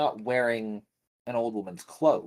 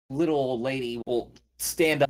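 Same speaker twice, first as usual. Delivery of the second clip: usual speaking voice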